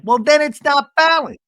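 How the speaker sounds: "then it's not balanced" yelled high and loud; high, loud imitation of an exasperated comeback, punching "then"; exasperated mock yell. Acting out Seth's exasperated comeback.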